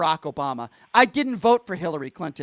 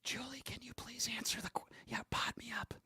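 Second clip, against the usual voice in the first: very soft, pointed whisper; 4 semitones higher, 19 dB quieter than usual. Real, not acted. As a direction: whisper the request into the microphone. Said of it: "I'm gonna whisper in the microphone".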